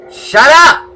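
sudden high yell. Real, not acted. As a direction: shout it at full force. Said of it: "I'm yelling at a mob".

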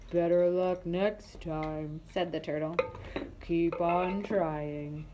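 low, slow turtle. Acted. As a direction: drag the words like an old turtle.

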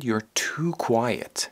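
"too" drawn out in a hushed complaint; stress on too. Shown as a demonstration. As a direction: lean hard on "too", critically. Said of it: "یعنی زیادی ساکتی".

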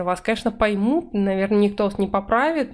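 usual speaking voice

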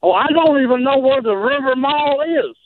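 ordinary talk

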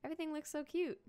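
high-pitched cooing excitement; thrilled, lifted voice; delighted. Real, not acted. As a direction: gush over something adorable. Delighted at first sight of house.

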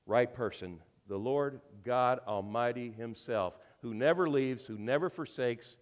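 normal talking voice

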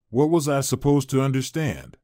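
ordinary delivery, nothing marked

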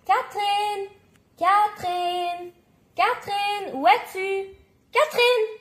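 high, drawn-out search call. A narrator's reading. Dad searching the store for Catherine, calling out. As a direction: call for someone lost across a store. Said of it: "en appelant".

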